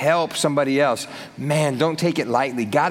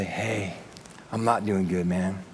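much softer, lower, defeated voice; downcast admission; 7 semitones lower, 8 dB quieter, slower than usual. Retold or imitated. A friend honestly telling the pastor, low and defeated. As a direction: let it sag out, barely there.

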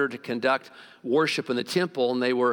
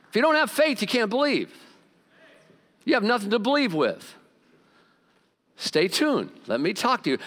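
higher, raised preaching voice; 8 semitones higher than usual. Acted. Pastor urging the congregation about faith, raised preaching. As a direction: drive the point home to the pews.

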